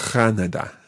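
"Canada" with a trilled rasp at the back of the throat. Shown as a demonstration. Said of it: "a little trill in the back of your throat".

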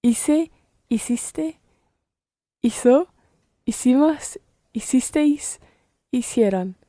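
Hice, hiciste, hizo, hicimos, hicisteis, hicieron with s for c and z; Latin American pronunciation. Shown as a demonstration. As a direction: repeat Hice to hicieron word by word, each c and z as s. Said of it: "using Latin American pronunciation".

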